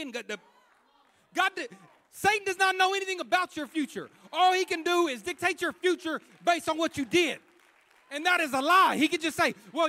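loud, high, driving sermon voice; preaching at full force. Acted. Preacher urging the congregation about sin, in full-force preaching. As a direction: preach it out hard to the room.

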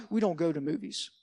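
low, soft, firm rule-setting; stern dad. Retold or imitated. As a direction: lay down the law like a strict father. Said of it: "to now he was like".

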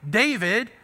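ordinary talk, nothing marked

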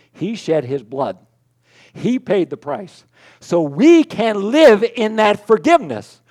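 slow, building to loud, high proclamation; preaching climax. Acted. Pastor proclaiming the paid debt to congregation: building preach. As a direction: proclaim freedom with rising force.

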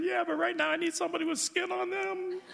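put-on high childlike voice, afraid; scared little girl. Little girl in thunderstorm telling mommy she is scared, high and afraid. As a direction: squeak it up like a frightened small child. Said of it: "that little girl".

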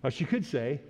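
usual speaking voice

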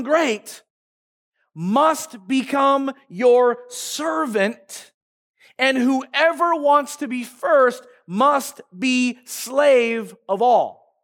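very slow, weighted verse reading; slow solemn scripture read; slow emphatic reading. Acted. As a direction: let each phrase land with long pauses. Preacher reads key verse slowly.

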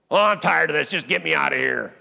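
acted exasperated outburst, starting high and loud; fed-up whine. Preacher mimicking believers begging God to leave, fed-up. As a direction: moan it out, mock fed-up.

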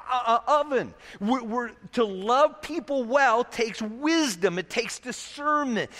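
ordinary talk, nothing marked

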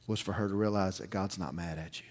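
hushed, breathy whisper of the key truth. Real, not acted. Preacher revealing the sermon's heart to the congregation, whispered. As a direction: lean in and whisper it like a secret.

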